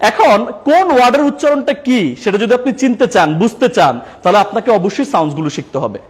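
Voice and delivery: ordinary talk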